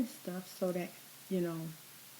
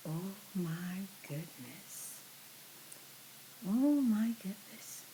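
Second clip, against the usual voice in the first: whispered amazement; whispering; 5 dB quieter, slower than usual. Real, not acted. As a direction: breathe it out in awe. Whispered delight.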